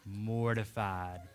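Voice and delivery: slow, low drawn-out word; mortified. Real, not acted. Preacher recalling the stalled car, slow mortified. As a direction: let the embarrassment sink. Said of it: "I was mortified".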